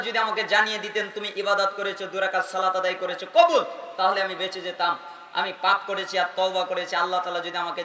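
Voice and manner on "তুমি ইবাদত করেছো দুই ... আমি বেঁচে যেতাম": ordinary delivery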